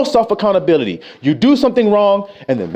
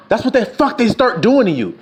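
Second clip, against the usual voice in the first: normal talking voice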